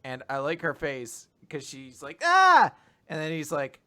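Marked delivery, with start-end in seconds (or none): 2.2-2.7 short, high, loud mock yelp; comic high-pitched yelp; startled yelp. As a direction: imitate a startled cry. Acting her startled face.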